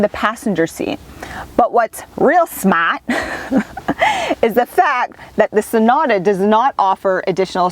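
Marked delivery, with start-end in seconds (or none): 3.1-4.4 bright burst of laughter; high laugh. Real, not acted. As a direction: laugh lightly. Laughs mid-sentence.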